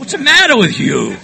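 loud, high mock scolding; mimicked scolding yell. Everybody berating him since childhood, loud mock scolding. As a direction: yell it like a fed-up parent.

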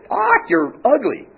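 high, drawn-out parrot squawk; insulting bird call, stretched and high. Acted. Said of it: "parrot goes".

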